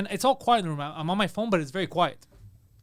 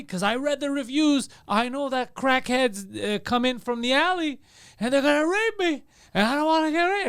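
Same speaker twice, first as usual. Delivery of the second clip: higher-pitched, slow mock alarm; drawn-out complaint rising in pitch; imitating alarmed guest; 5 semitones higher, slower than usual. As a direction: act out a worried hotel guest, building higher. Imitating alarmed female hotel guest.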